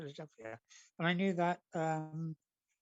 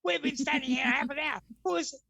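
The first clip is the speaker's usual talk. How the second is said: irritated queuers, growing higher and louder; annoyed crowd; 7 semitones higher, 4 dB louder than usual. Retold or imitated. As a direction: build from grumbling to a high indignant complaint. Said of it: "And they were going".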